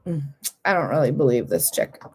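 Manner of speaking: low, soft doubting aside; imitated skepticism. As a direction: play the doubtful colleague under your breath. Acting out doubting colleagues.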